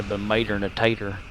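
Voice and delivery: "mater" said MAY-ter and "tater" said TAY-ter, both dropping the first syllable; Southern "mater" and "tater" instead of "tomato" and "potato". Shown as a demonstration. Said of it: "Like, not a tomato".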